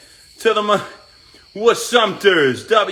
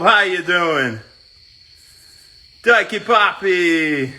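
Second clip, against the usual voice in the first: ordinary talk, nothing marked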